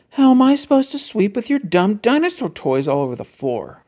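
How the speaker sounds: whiny sister protesting over the sweeping; Sister arguing. A narrator's reading. Sister complaining to Brother about his dinosaurs, arguing. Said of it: "argued Sister".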